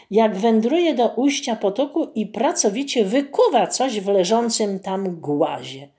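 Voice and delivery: ordinary delivery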